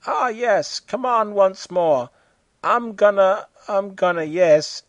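high, hammed-up moaning; mock moaning. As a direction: ham up the suggestive moans. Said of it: "groans and moans".

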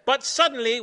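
ordinary delivery

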